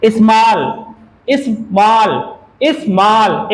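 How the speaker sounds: स्मॉल split as इस, then मॉल; two syllables. Shown as a demonstration. Teacher demonstrates syllables to the class, स्मॉल split as इस-मॉल. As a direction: sound out स्मॉल in two separate beats. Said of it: "एक बार इस बोल लें".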